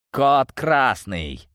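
high, slow, forceful command; vicious. A narrator's reading. Leader declaring an alert by phone, vicious. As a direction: stretch the alarm code angrily. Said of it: "злобно произнёс глава".

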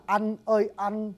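"anh ơi anh" with "anh" halfway between a and e; Southern pronunciation. Shown as a demonstration. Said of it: "âm A N H của người Nam".

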